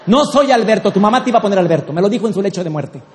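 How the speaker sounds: loud, quickening protest; indignant husband. Acted. Husband correcting wife about his name, indignant. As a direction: object loudly, then rattle off the reason. Husband retorts to being renamed.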